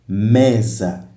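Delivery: "Mesa" with a strong ME, falling away; teacher's demo of ME-sa; stress on first syllable. Shown as a demonstration. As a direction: punch the ME in "Mesa". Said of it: "a sílaba me é a tônica".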